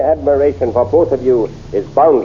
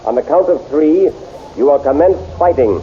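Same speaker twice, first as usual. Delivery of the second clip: ordinary talk, nothing marked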